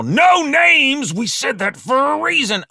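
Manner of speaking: loud, high-pitched scolding; furious shouted rebuke; angry shout. Acted. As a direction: yell the reprimand at your partner. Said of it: "shouted Carl".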